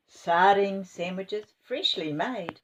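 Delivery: ordinary delivery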